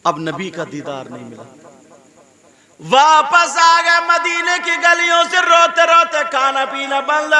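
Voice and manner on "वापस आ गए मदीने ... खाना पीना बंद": high, loud, slow wailing sermon half sung; tearful preaching. Acted. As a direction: preach the son's grief at full force. Preacher at full force, crying scene. (ऊँची, धीमी, रोती हुई तक़रीर)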